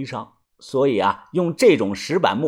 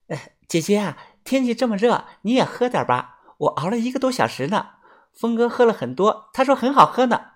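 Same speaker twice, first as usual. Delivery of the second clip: lightly voiced sweet girl; 8 semitones higher than usual. A narrator's reading. Sweet girl offers soup.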